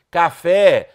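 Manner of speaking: Café said loud with a long final FÉ; stress on FÉ. Shown as a demonstration. Teacher recalling the base word, Café loudly stressed on FÉ. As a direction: punch the last syllable of Café. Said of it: "a sílaba tônica era fé".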